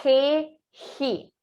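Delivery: "Re, ri" with the R rasped from the throat; throaty Spanish G. Shown as a demonstration. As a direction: say "Re, ri" like the R of Portuguese rato. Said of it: "Ele vem da garganta".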